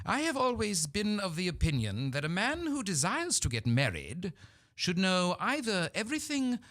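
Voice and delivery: high, haughty British matriarch, slowing on the question; stately Victorian dowager interrogating a suitor; lofty upper-class British lady voice; Lady Bracknell. Acted. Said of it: "Lady Bracknell asks Jack".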